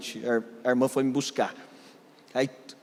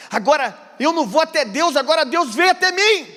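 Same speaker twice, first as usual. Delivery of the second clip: higher and louder, building fervour; fervent preaching; 13 semitones higher, 6 dB louder than usual. Acted. Pastor exhorting the congregation, loud and fervent. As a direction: preach it out to the whole church.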